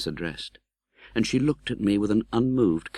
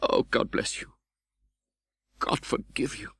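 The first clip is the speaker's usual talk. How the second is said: half-whispered, softer blessing through tears; tearful farewell; 4 semitones higher, 4 dB quieter than usual. Acted. Pip weeping over Estella's hand, whispered blessing. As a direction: bless her as you weep. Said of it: "my bitter tears fell fast".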